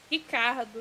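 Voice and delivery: Ricardo in Brazilian Portuguese, R said like H; Brazilian accent. Shown as a demonstration. Streamer re-saying a game name as a Brazilian name, Brazilian accent. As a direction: say Ricardo as "Hee-KAR-doo", Brazilian style. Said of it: "say their name with a Brazilian accent".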